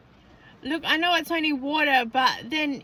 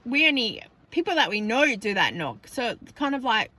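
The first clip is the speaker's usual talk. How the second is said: ordinary talk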